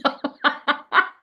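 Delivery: high breathy burst of laughter; can't hold back the laugh; helpless laugh. Real, not acted. Laughing at what her niece told her. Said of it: "I'm not even laughing now".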